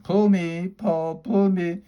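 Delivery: high, slow, coaxing little voice; tiny tempting voice calling out; little pigtail voice. A narrator's reading. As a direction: tempt him from the pigtail, slowly. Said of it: "a little voice coming from the pigtail".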